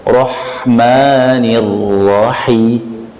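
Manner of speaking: slow Rahmanirrahim with firmly stressed ra; deliberate firm recitation. Shown as a demonstration. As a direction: say Rahmanirrahim slowly, pressing each ra.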